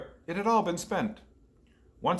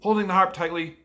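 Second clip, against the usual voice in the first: ordinary delivery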